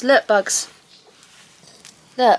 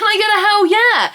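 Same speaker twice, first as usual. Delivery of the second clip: high, rallying cheer; hyped-up call; 11 semitones higher, 5 dB louder than usual. Real, not acted. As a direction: whip the crowd up. Hyping up the audience.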